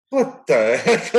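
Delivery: slow, baffled mock disbelief. Umpire retelling the manager's snub, slow baffled disbelief. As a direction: drag it out like you can't believe the snub. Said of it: "I'm like".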